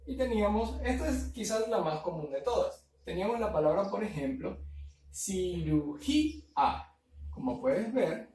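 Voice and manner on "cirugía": cirugía drawn out slowly, syllable by syllable; split into syllables. Shown as a demonstration. Teacher separates cirugía into syllables for the class. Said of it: "aquí se forma el hiato".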